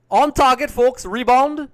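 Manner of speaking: high, loud goal-chance shout; excited commentary. Real, not acted. Commentator calls a shot on target to viewers, excited shout. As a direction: call out the shot at full pitch.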